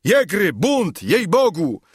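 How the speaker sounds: high, loud call for help; frightened shout. A narrator's reading. Scared captain summons his soldiers, high frightened shout. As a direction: cry out to the troops in alarm. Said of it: "krzyknąwszy".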